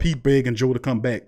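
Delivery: ordinary talk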